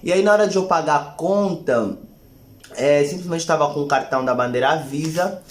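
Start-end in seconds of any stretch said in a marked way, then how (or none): none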